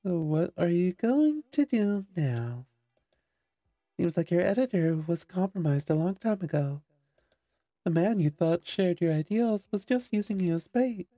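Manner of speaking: high, loud mocking villain voice; taunting villain. Game villain taunts the heroine.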